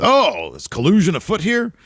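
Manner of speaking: high-pitched mock Michael Hayes voice; Doc Hendricks impression. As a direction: put on a lifted, showy wrestler voice for the quote. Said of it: "Michael P S A says".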